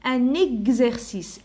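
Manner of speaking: "Un exercice" slow, x said gz and linked. Shown as a demonstration. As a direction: stretch "exercice" so the gz is heard. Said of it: "X entre deux voyelles". ("Un exercice" ببطء مع نطق x بصوت gz)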